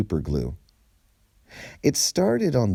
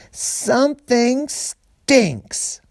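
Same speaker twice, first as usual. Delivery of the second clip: high, drawn-out sneering taunt, nose pinched; mocking announcement; 3 dB louder, much slower than usual. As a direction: announce it slowly to the whole bus, nose held. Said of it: "Charlene pinched her nose and announced".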